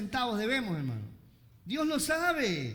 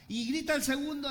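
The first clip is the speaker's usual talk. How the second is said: normal talking voice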